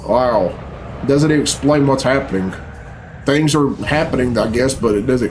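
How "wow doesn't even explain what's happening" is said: ordinary talk, nothing marked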